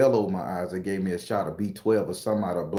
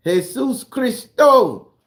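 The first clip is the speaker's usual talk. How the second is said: mock greeting; 14 semitones higher, 9 dB louder, much slower than usual. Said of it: "what they say?".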